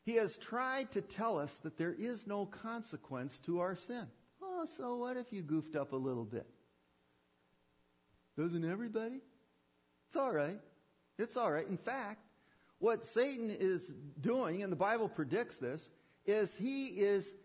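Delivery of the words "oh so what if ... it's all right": Satan's coaxing voice. Acted. Preacher voicing Satan's soothing lie.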